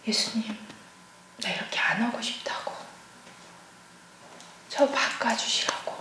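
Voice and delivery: soft, whispered plea, slowing at the end; barely voiced, begging Jesus to change her; whispered prayer. Mother praying to Jesus about her anger, whispered plea.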